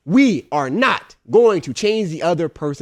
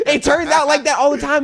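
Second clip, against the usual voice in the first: ordinary delivery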